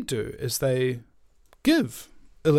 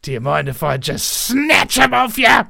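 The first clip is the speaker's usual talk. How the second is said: high, loud sudden snatch; grabby shout; 8 semitones higher, 9 dB louder than usual. Acted. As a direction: yell it as you grab. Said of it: "the free radical goes up to the cell and goes".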